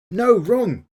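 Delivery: loud, high-pitched playful rebuttal; mock-outraged objection. Host rejecting a viewer's comedy argument, mock-outraged. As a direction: shoot it down with exaggerated indignation.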